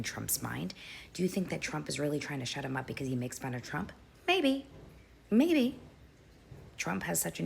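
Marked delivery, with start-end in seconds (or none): 4.3-5.8 emphatic maybe. Real, not acted. Emphatic agreement about Trump's ego.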